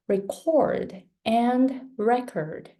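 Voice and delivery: slowly, first "record" stressed on CORD, second on REC; contrasting syllable stress. Shown as a demonstration. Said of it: "people make stressed syllables".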